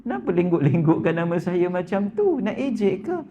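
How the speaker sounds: a baffled king asking why; puzzled Sultan. Retold or imitated. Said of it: "Sultan pun pelik". (Sultan kehairanan bertanya dengan nada tinggi)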